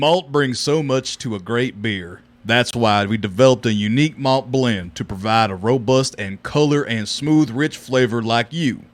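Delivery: low, slow announcer read; deep, drawn-out Texan ad voice; truck commercial voice. Retold or imitated. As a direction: sell it like a Ram truck ad. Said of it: "reading the whiskey bottles and I was doing the Hemi voice".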